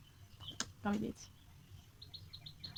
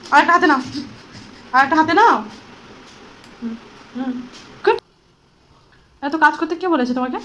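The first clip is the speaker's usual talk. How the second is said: playful excited mock-scolding; 3 semitones higher, 14 dB louder than usual. Real, not acted. Mother playfully scolds dancing toddler.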